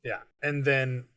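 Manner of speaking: ordinary delivery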